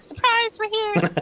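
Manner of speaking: high, squeaky "surprise" sprung on them; playful surprise. Pretend. Jumping out at Bigfoot with a high cheery surprise.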